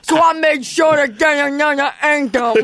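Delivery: lightly voiced blustering rapper. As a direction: shout it like a bragging rapper. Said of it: "He's like".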